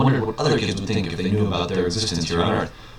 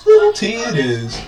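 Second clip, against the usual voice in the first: high squeaky exclamation; 13 semitones higher, 7 dB louder than usual. Acted. Riz naming the baby in a high squeal. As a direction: jump way up and shout the name.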